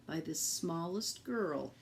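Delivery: usual speaking voice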